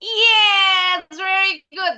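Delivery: high, loud "Yes, very good"; excited praise. Real, not acted. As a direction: cheer the pupil warmly. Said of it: "praising pupil".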